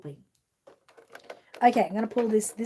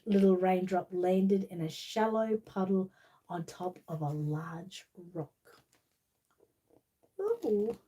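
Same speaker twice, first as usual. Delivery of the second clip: slow, lively storybook reading; reading to children; slower than usual. Acted. Reading The Little Raindrop to child viewers, storybook voice. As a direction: read it like bedtime story time. Reads picture book to children.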